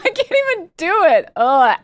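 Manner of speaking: loud, high, laughing exasperation. Real, not acted. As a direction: burst out laughing while giving up. Laughing at failed accent attempt.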